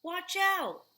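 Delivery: high, loud urgent call; warning shout. Acted. Someone warning in the cafe, high urgent shout. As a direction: shout to stop a spill.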